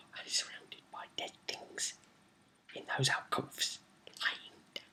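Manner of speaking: eerie storyteller's whisper; barely voiced, suspenseful whisper; hushed whisper. A narrator's reading. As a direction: whisper the creepy reveal. Suspenseful reveal of the dead.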